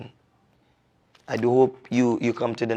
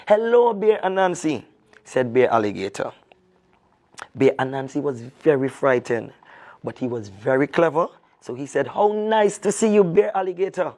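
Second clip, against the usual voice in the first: lively storyteller reading a folk tale; storyteller voice reading to children; story narration; 4 semitones higher than usual. A narrator's reading. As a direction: tell it like a children's story, expressive and clear. Reads the folk tale aloud.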